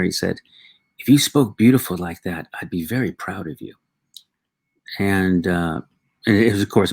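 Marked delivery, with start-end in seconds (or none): none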